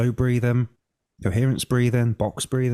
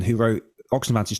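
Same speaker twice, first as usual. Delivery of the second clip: normal talking voice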